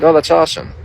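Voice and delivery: bright high casually pleased reply; high breezy mock-cheerful "oh that's awesome"; casual delighted reply. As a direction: toss it off lightly, pleased. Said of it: "And I'm like".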